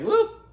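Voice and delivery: loud rising "whoop" sound effect; quick comic whoop, swooping up; playful whoop. Retold or imitated. Host mimicking a top flying off, playful rising whoop.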